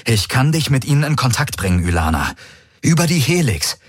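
high, pressing warning to the fleet; urgent envoy. A narrator's reading. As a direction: plead urgently over the comm, pitched high. Envoy offers contact. (eindringliche, hohe Warnung an die Flotte)

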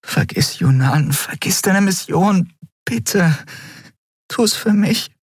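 imploring high voice; pleading. A narrator's reading. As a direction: beg with pauses between phrases. She pleads with him to stay.

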